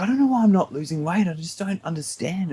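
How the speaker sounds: imitated whinging. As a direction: lightly mimic a baffled coworker whinging. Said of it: "constantly whinging".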